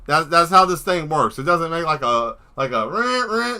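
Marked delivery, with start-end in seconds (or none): 2.9-3.6 high imitated siren bleats; mock a silly alarm with two high rahs; pitched-up toy alarm noise. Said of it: "doesn't do any of that silly shit".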